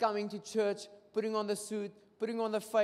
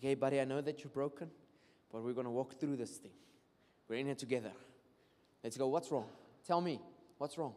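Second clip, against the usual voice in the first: gentle; 5 semitones lower, 3 dB quieter than usual. Shown as a demonstration. Preacher shows how to speak gently to a broken person, soft and tender. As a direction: lower and softer, tender reassurance. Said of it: "in the spirit of gentleness and kindness".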